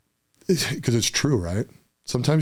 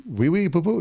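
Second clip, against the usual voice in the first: mock French; 8 semitones higher than usual. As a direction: silly fake French accent, pitched way up. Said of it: "they get into a French movie".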